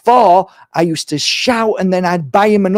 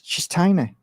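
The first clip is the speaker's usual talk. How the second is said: ordinary talk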